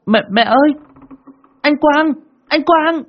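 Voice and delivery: high, loud panicked yell; shouting. Acted. Child screams for mother on finding brother, panicked yell. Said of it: "tôi hét lên".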